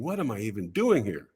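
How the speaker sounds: low, soft self-doubt; understated private worry; inner thought. Retold or imitated. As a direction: think it aloud, softly to yourself. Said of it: "And I'm thinking".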